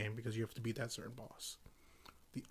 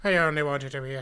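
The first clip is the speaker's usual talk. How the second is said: high, loud hammed-up Irish accent; mock Irish lament, much higher and louder; 7 semitones higher, 8 dB louder than usual. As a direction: squeal it like a hammy Irish woman. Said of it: "she was already Irish".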